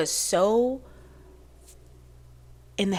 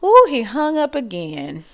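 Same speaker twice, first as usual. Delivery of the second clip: a higher old-lady voice, chatty and distracted; 4 semitones higher than usual. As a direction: play a scattered seventy-five-year-old mother. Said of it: "seventy-five-year-olds that talk like that".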